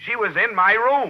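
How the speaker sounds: slow, high pointed protest; exasperated insistence. Acted. Frustrated detective protests to the superintendent. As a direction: stress each word in frustration.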